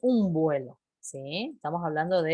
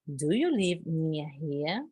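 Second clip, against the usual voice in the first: slow model question; 4 dB quieter, slower than usual. Shown as a demonstration. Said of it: "teacher models question slowly".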